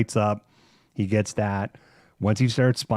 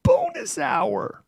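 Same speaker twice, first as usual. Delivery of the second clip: much higher, slow, drawn-out coaxing voice; mock sing-song reminder; much slower than usual. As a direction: stretch bonus hour out like pleading with the cat. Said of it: "So I'm like".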